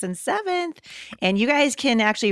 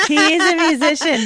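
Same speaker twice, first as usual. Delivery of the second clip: laughing; 8 semitones higher than usual. Real, not acted. Host joking with guest about her musician husband, laughing. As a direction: say it while cracking up.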